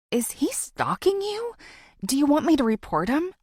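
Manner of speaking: worried driver checking on her; concerned driver. A narrator's reading.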